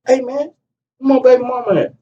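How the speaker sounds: high, pressing ask; pressing question. Retold or imitated. Man in the trap house asking for the mother, high and pressing. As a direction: demand where she is. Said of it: "I like".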